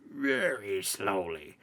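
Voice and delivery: slow, odd put-on voice for the doll; crazy voice. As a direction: drag the words out like a creepy doll. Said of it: "would answer in his crazy voice".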